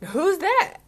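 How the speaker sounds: high, puzzled "who's that"; mock surprise. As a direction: pretend not to recognise a friend. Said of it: "And I'm like".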